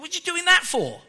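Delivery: high, loud disbelieving jab; incredulous mock rebuke. Preacher voicing scolding of Peter, high and loud incredulity. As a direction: scold him like it's absurd. Mocking Peter looking at wind.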